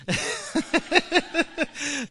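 high delighted laugh; a short high giggle; real laugh. Real, not acted. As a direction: laugh at yourself, pleased. Laughs at own drawing.

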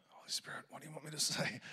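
hushed whisper to God; whispered prayer. Shown as a demonstration. Demonstrating a quick in-the-moment prayer, whispered. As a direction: breathe the question under your breath. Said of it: "you could do it in the moment".

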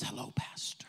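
whispered greeting; soft whisper. Acted. Mary greeting the pastor in a soft whisper. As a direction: breathe it gently. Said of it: "she smiles and says".

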